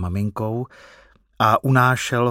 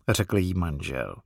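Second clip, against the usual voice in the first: ordinary delivery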